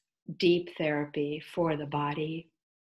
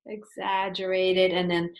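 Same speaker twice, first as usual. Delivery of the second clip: unhurried, soothing yoga nidra guidance with long pauses; guided meditation. Acted. Teacher leading listeners into yoga nidra, guided meditation voice.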